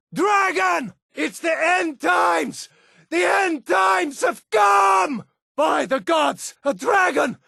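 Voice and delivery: high, terrified shrieks drawn out in panic; panicked screaming. Acted. Townsfolk shrieking as the dragon attacks, terrified. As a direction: cry out as if the world is ending.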